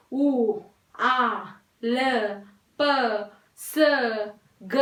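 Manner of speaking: each sound "उ, आ, ल, प, स, ग" said alone with clear mouth shape; sound-by-sound demo. Shown as a demonstration. Teacher showing pupils mouth movements, sound by sound. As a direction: isolate each letter sound with exaggerated mouth. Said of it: "different different के type के हमारे movement".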